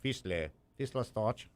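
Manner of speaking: softly hammed-up German accent; put-on German accent. Shown as a demonstration. Host showing off the airplane's German name, put-on German accent. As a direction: say it quietly in a mock German accent. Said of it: "I did enough accent there for you".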